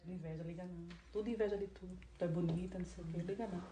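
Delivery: hushed, comforting a friend; low and soft, soothing her; soft reassuring. Acted. Comforting her sim friend, softly.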